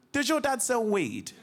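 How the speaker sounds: high, slow, sly question; cheeky kid. Retold or imitated. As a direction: lean in with a naughty question. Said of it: "I'm like".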